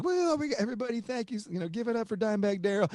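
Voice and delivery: high, bright thank-you to the crowd; stage announcer. Retold or imitated. Band thanking the crowd after the song, announcer voice. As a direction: thank the arena like a frontman. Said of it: "and they're like".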